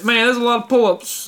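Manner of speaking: a hint of the impressed recruiter; Southern dude impression. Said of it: "he was like".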